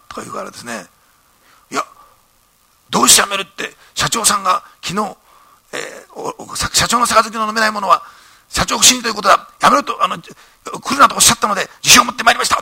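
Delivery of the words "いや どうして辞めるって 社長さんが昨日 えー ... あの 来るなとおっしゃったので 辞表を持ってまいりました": high, loud earnest pleading; young employee pressing his case, raised high and loud; earnest protest. Retold or imitated. Young employee explaining his resignation to the boss, high and loud.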